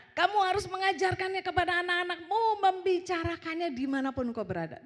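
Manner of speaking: loud, high scripture declaration; khotbah lantang. Real, not acted. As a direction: proclaim the verse to the congregation. Membacakan ayat dengan lantang. (seruan ayat yang lantang)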